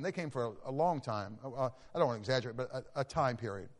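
ordinary talk, nothing marked

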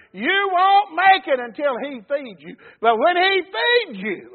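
high, slow, drawn-out pulpit cry; fervent preaching. Real, not acted. Preacher warns congregation to be fed, crying fervent preaching. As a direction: stretch each phrase and lift it high.

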